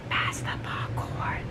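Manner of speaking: slow voiceless whisper; a nosy spectator whispering for snacks; whispered aside. Retold or imitated. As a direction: lean in and whisper it gleefully. Bystander enjoying the drama.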